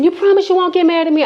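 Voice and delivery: high, fast wheedling; pleading friend. Retold or imitated. Guilty friend asking again, high and quick. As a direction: beg anxiously like the friend. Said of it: "she said".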